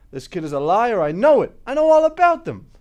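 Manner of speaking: loud, heated accusation; forceful outburst building to a shout; angry insistence. Acted. Juror insists the kid is lying.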